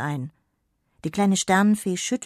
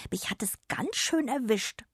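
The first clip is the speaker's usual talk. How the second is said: hoarse sick whisper, higher; 6 semitones higher, 3 dB quieter than usual. Acted. Feverish fairy tells Jonna she's caught a cold, whispered.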